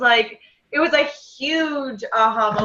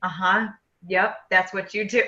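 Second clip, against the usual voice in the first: lower, quieter knowing husband; retold husband; 4 semitones lower, 4 dB quieter than usual. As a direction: imitate a husband's low, matter-of-fact agreement. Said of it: "and he's like".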